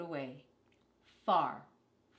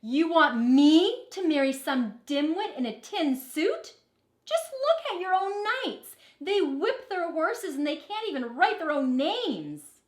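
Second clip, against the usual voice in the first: louder and higher, scornful protest; indignant outburst; 9 semitones higher, 9 dB louder than usual. Acted. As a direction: let her fury spill out at father. Princess protests to her father.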